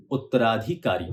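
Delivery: usual speaking voice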